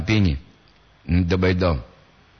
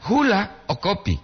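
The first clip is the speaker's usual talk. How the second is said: "hula" high and set apart, then "o kopi"; pause before O KOPI; 8 semitones higher than usual. Shown as a demonstration. Teacher showing the intonation, "hula" split from "o kopi". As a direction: break right after "hula", join "o kopi".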